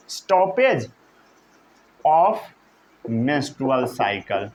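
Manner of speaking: very slow, word by word; slow dictation. Shown as a demonstration. Teacher dictating a definition to the class, very slowly. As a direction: spread the term out with long pauses.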